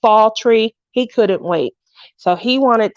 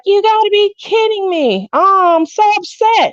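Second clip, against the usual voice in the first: much higher, mock exasperated cry; exasperated disbelief; 10 semitones higher than usual. Retelling her reaction when the tree lights went out, mock exasperated. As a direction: replay the moment the lights died. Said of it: "So I was like".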